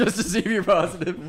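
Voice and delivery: laughing through the words; giggling while repeating the joke. Real, not acted. Friends joking about drug tests, laughing.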